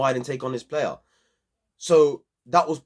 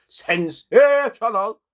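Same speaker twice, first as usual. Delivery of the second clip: drawn-out, higher and louder Sensational; slow, high, loud [name]-style hype; 6 semitones higher, 5 dB louder, much slower than usual. Retold or imitated. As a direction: stretch out Sensational slowly like a hyped singer. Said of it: "like [name] blood".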